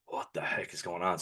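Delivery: a bewildered murmur under his breath; stunned inner voice. Retold or imitated. Retold to a friend, his hushed reaction to the police.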